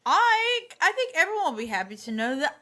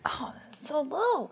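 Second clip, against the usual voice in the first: whisper; 5 dB quieter, slower than usual. Real, not acted. Disappointed whisper at low roll.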